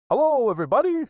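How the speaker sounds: high, loud bouncy greeting; loud happy tiger hello; cheerful Tigger. Acted. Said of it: "said Tigger cheerfully".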